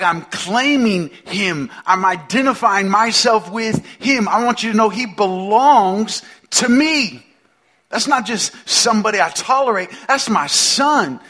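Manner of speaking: high, loud, emphatic fatherly pride; proud claiming. Acted. God tells the world he belongs to me, proud and insistent.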